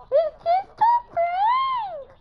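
very high, slow mock wail; joking high voice. Player kidding teammates, silly high-pitched cry. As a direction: stretch it out high and loud.

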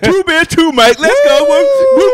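very high, loud party whooping; hyped-up shouting. Pretend. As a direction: whoop it up to start drinking. Hyped shouting before cracking beers.

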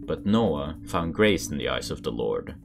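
usual speaking voice

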